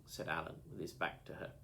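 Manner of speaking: ordinary delivery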